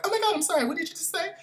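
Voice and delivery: high, chirpy apology; perky barista. Retold or imitated. Mocking the barista asking to repeat, chirpy. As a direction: sing it out like a bubbly barista. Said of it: "the girl's like".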